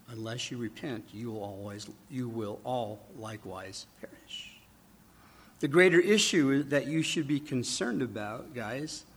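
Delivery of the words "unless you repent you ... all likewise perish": hushed warning. Retold or imitated. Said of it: "Then he says".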